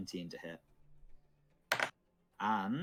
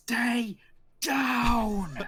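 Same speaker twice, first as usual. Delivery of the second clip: high, loud full-voiced shout; screaming; 11 dB louder than usual. Game master voices an attacker stabbing a player, screaming. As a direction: scream it as a furious command. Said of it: "As he's just screaming".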